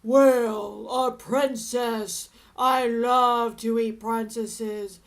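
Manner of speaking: a big lazy dragon brushing off a princess; booming dragon. A narrator's reading. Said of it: "The dragon stuck his nose out of the door and said".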